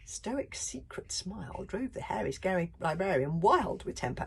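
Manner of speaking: ordinary delivery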